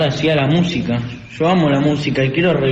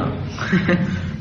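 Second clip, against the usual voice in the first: soft airy chuckle at a silly band name; quiet giggle at a joke; breathy laugh; 4 semitones higher, 6 dB quieter than usual. Acted. Joking about band names.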